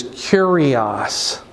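"kurios" slowly, KOO-ree-os; Greek word sounded out. Shown as a demonstration. Teacher gives the Greek word for Lord, slow and careful. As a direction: lay out "kurios" syllable by syllable. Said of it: "the word for Lord, which is".

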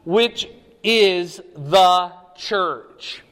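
word by word, landing heavily on church; stress on THE CHURCH. Real, not acted. Preacher reading the verse's key answer, stress on THE CHURCH.

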